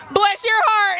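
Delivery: put-on high, loud Texas sweetness, Southern accent; sugary Texas accent, a hint of a put-down; Texas bless your heart. Southern speaker quoting a Texas put-down in a sugary Texas accent. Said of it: "if I were from Texas, what would I say".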